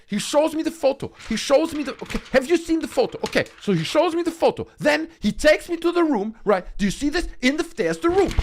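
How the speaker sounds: hammed-up overexcited storyteller who can't sit still; mock frantic Uri Geller. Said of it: "The camera can barely follow Uri Geller because he's like".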